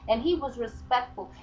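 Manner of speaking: normal talking voice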